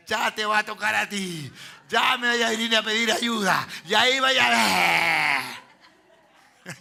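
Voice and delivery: loud, hammed-up vengeful yell; resentful outburst. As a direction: act out a grudge at full volume, building to a shout. Acting out a resentful person's threat.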